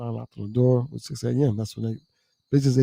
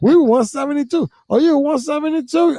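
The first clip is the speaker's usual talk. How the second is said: calling out; 13 semitones higher, 4 dB louder than usual. Retold or imitated. Shouted exchange retold.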